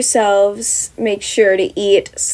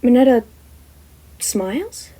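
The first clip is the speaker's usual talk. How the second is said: usual speaking voice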